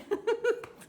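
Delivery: high, bright burst of laughter. Real, not acted. Laughing at herself after singing to viewers.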